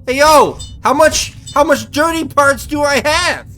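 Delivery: high, shouted mock outrage; loud protest. Real, not acted. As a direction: yell in disbelief at the game.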